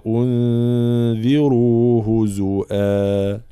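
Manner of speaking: slow, melodic tajweed chant; slow chanted Quran recitation. Shown as a demonstration. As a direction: recite each word drawn out and measured. Teacher recites verse.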